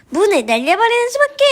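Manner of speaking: reckless resolve. Acted. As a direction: announce a drastic plan, pitch rising. Robber decides to blow up door.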